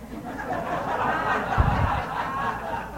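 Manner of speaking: long breathy laugh; quiet wheezing laugh. Real, not acted. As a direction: laugh at the punchline. Laughs at friend's argument.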